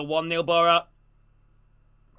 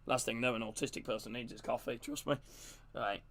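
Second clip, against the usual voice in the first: much softer, lower throwaway remark; muttered aside; 8 semitones lower, 15 dB quieter than usual. Real, not acted. Telling chat about coffee, softly muttered aside. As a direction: say it half under your breath.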